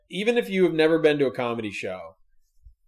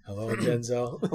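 bad Denzel impression; 2 semitones lower, 6 dB quieter, slower than usual. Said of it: "Also do Denzel".

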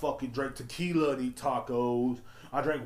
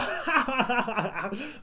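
laughing through a mock 'day uno', pitched up and loud; laughing mockery; 12 semitones higher, 8 dB louder, slower than usual. Podcaster laughing at the Taco Bell slogan, mock delivery. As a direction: scoff at the slogan while cracking up.

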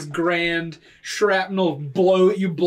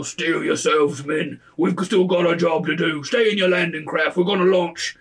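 commander; 4 dB louder than usual. Acted. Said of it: "you hear other people".

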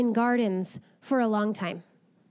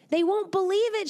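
laughing; 8 semitones higher than usual. Acted. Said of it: "she laughed".

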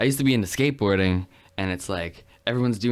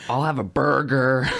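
slow, higher hammed-up American accent; mock American accent; 4 semitones higher, 4 dB louder than usual. American ordering food beside a British bandmate, mock American accent. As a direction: ham up a drawn-out American accent. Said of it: "they don't say 'em quite like that".

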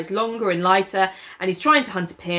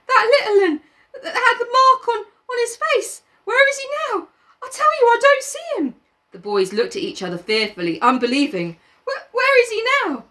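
put-on much higher anxious boy; frightened Piggy. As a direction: keep asking where the child went. Piggy voice held.

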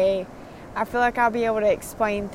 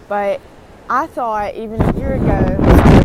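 raised voice against the wind; shouting over wind; 10 dB louder than usual. Real, not acted. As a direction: push the voice over loud wind. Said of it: "this wind is insane today".

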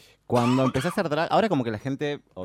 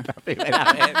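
squealing through a laugh; 6 dB louder, slower than usual. Real, not acted. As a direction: laugh the words out, high. Echo with laugh, high squeal.

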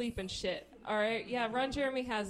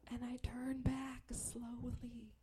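slow, hushed, drawn-out dread; soft slow-motion telling; slow suspense; 3 semitones higher, 8 dB quieter, slower than usual. Acted. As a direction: stretch it out almost in a whisper. Suspenseful slowed narration.

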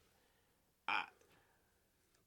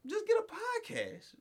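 mocking squeak; slower than usual. Real, not acted. Mocking advice, voice leaps.

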